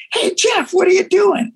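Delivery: high shout from a car window; calling out. Retold or imitated. From the car, calling to Jeff on the street, high shout. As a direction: yell across the street to him. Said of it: "I go".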